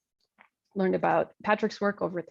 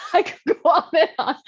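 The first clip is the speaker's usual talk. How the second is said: laughing; 8 semitones higher, 6 dB louder than usual. Real, not acted. Laughing at her food list.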